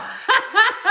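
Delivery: shrieking laugh; real high laugh; high squealing laugh. Real, not acted. Laughs at the scene.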